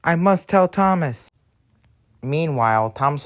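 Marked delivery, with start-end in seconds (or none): 0.0-1.3 high, distressed resolve; urgent cry. Acted. Engine off to tell Thomas, urgent cry. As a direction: pitch it up in mild alarm. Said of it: "cried Stanley".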